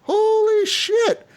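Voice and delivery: high, long drawn-out astonishment; stunned amazement. Retold or imitated. Player watching the magician catch the bullet, astonished. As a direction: stretch every word in retold disbelief.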